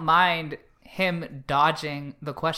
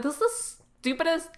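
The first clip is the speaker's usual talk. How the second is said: exasperated rant; 6 semitones higher, 6 dB louder than usual. Retold or imitated. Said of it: "And I'm like".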